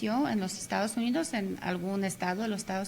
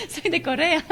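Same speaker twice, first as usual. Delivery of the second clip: high, louder, breaking into laughter; laughing through it; 8 semitones higher, 4 dB louder than usual. Real, not acted. Guest laughing while quoting the shopkeeper's retort to Douglas. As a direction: laugh out the punchline as you say it. Retelling film punchline, laughing.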